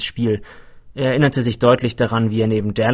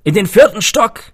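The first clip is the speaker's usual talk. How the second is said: loud, high shout from above; carrying, high-pitched holler; calling down; 11 semitones higher, 8 dB louder than usual. A narrator's reading. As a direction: call out the floor to someone below. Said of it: "rief ein Mann von oben".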